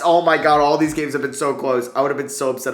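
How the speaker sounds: loud, breathless relief; relieved cheer. Real, not acted. Gamer after a one-point win, loud relieved cheer. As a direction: let the tension burst out.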